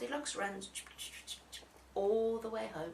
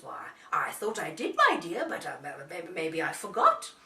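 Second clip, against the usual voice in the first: Daddy bear; 5 semitones lower, 6 dB louder than usual. A narrator's reading. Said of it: "And the daddy says".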